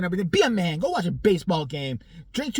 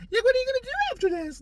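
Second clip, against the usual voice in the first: very high, fast put-on voice; mock Bernice; 3 dB louder, much faster than usual. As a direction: rattle it off in a squeaky mock voice. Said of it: "that's what she did".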